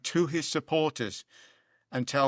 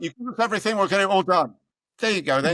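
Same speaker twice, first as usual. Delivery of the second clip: higher, louder mocking boast; mocking a boastful politician, pitched up; mock campaign promise; 7 semitones higher, 6 dB louder, faster than usual. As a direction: parody the politicians' big promise. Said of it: "It's just like".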